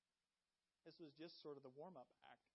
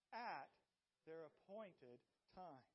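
"At their appointed time" stretched slow, each word weighted; slow emphatic phrase; slower than usual. Shown as a demonstration. Teaching the congregation the key phrase, slow and weighty. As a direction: lead the congregation through it slowly. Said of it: "Can you say that with me?".

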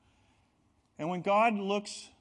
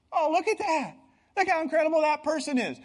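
much higher and louder, marvelling; admiring museum visitor; 11 semitones higher, 8 dB louder than usual. Pretend. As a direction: gasp in awe at a masterpiece.

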